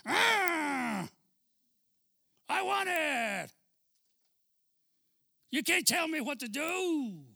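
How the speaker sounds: high, slow, shouting brat voice; spoiled child yelling. Retold or imitated. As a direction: yell it like a spoiled kid who demands everything. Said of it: "le va a hacer así, mire".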